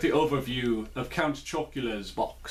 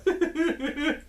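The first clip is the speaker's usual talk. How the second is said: high burst of laughter; 14 semitones higher than usual. Real, not acted. Laughs at own dance.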